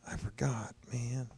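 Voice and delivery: high, hushed put-on inner voice; mock sudden remembering. Commentator voicing a witness's forgotten cue, soft mock dismay. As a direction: imitate someone softly kicking themselves for forgetting. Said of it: "she remembered".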